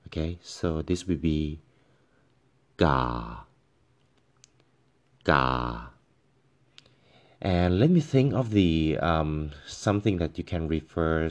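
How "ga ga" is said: ga starting mid and falling lower. Shown as a demonstration. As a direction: drop ga down from the middle. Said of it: "start in the middle and then falls down".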